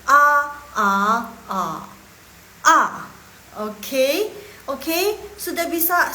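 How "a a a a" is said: separated A's, third tone only half read. Shown as a demonstration. Teacher showing a half third tone among four, deliberate A's. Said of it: "baca setengahnya aja kayak gini".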